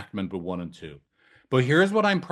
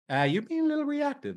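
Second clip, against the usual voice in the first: acted therapist. Shown as a demonstration. Therapist voiced in role-play.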